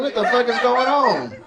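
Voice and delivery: overhearing the fight, mock panic; startled. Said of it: "sounded like ... a soap opera".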